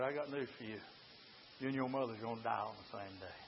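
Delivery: very soft, low devil voice; devil's taunt. Acted. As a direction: drop low and quiet to deliver the threat. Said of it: "And here is the devil".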